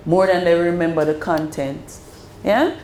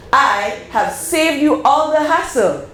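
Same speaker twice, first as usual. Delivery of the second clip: infomercial; 6 semitones higher than usual. Acted. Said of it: "I sound like a infomercial".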